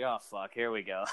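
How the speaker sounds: low, quiet aside; resigned dread. Retold or imitated. Partner sees his temper coming, quiet resigned dread. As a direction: imitate a partner bracing herself, under the breath.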